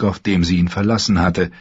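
ordinary delivery, nothing marked

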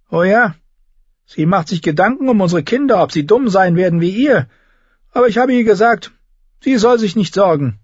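high, sneering king voice; mocking boy-king. A narrator's reading. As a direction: belittle her like a cruel child. Joffrey taunts Sansa. (hoher, spöttischer Knabenkönig)

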